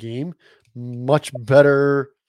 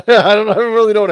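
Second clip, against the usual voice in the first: laughing; 7 semitones higher, 9 dB louder, faster than usual. Real, not acted. Laughing reply.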